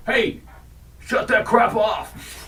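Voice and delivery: slow high shout; mock yell. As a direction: yell at them to shut it off. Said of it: "we'll say".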